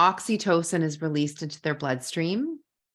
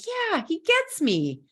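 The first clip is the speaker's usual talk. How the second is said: relieved wife; 11 semitones higher, slower than usual. Pretend. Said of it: "that would be like".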